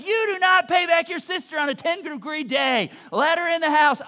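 loud, high exasperated scolding; mother scolding her son on the phone; scolding mom. Retold or imitated. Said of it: "And she's like".

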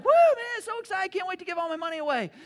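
high, loud, fast mock whoop; over-the-top excitement. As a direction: ham up a giddy giver. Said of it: "now it's not like".

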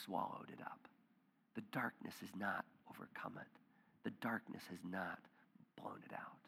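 soft, breathy hush of a whisper; whispered scripture, drawing listeners in; hushed reading. Acted. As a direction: let the words fall to a whisper.

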